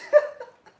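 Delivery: loud, high burst of laughter; giddy laugh. Real, not acted. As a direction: laugh out with joy. Giddy after workout.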